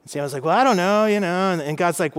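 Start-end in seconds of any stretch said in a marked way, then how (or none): 0.4-1.5 sheepish Samuel. Retold or imitated. Samuel shrugging to God.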